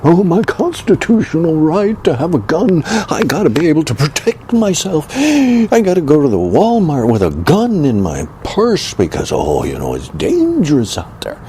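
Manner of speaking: high, whiny mock of a gun owner; mocking gun nut. Mocking Republican gun nuts in a high whiny squawk. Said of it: "gun nuts squawking".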